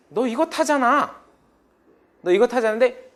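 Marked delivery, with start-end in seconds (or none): none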